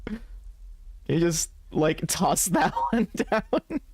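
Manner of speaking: high, drawn-out plea broken by laughter; half-laughing mock plea. Player reads a goofy cigarette plea, half-laughing. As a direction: plead playfully, stretching it out and cracking up.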